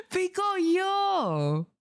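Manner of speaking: drawn-out, high, excited call; excited greeting. Real, not acted. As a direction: call the name out happily. Greeting viewer by stretched call.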